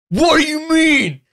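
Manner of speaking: high, slow, indignant mock-roommate voice; hammed-up hungover roommate taking offence; angry roommate. Hungover roommate snaps at teller over pot, hammed-up high indignation. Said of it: "And he's like".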